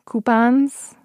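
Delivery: playful Midwestern accent. Shown as a demonstration. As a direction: punch "Coupons" out in a broad Midwestern accent. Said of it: "They feel very Midwestern to me".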